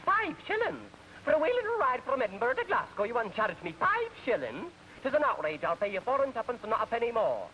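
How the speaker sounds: put-on a stingy Scotsman protesting his fare in a broad Scottish accent; indignant Scottish accent. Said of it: "And the Scotch says".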